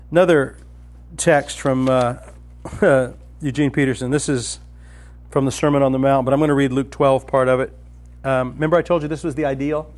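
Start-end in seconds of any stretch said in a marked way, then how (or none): none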